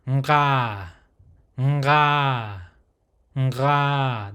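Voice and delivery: "nga" with nasal onset into the throat; held guttural "nga", nasal start; nasal guttural open syllable. Shown as a demonstration. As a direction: draw out "nga", mouth open. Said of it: "So that nasal sound".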